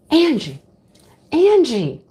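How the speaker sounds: calling out. Retold or imitated. Shopper to friend Angie over a yarn find, calling out.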